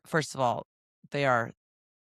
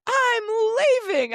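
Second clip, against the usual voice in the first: put-on higher, louder "I'm leaving" like a sulky kid; imitating her son; 6 dB louder than usual. Mother imitates her son storming out, higher and louder. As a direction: play the runaway seven-year-old. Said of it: "he's like".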